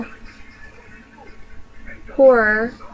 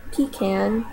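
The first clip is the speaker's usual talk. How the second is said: ordinary delivery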